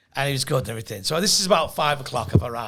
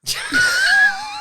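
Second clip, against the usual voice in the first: very high, loud burst of laughter; shrieking laugh; 5 dB louder than usual. Real, not acted. Comic spotting Jim in the photo, shrieking laugh.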